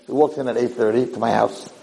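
normal talking voice

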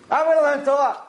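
high-pitched insistent declaration; mildly impassioned retold vow; retold resolve. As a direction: declare it as the yearning son. Son's retold resolve, then crying.

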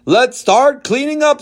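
loud, high, beaming politician; showy mayor. Acted. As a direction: play the grinning mayor for cameras. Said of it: "with a huge smile".

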